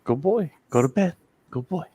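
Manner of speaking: high praise for a pet; talking to a dog. Real, not acted.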